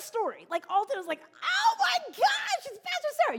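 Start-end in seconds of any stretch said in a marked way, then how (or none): 1.2-3.4 very high, loud squeal; drawn-out high shout of delight; excited kid. Retold or imitated. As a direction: imitate a child shrieking with excitement. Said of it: "somebody got that excited about seeing you".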